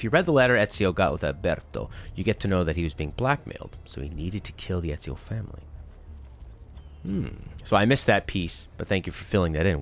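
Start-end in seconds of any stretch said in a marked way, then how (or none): none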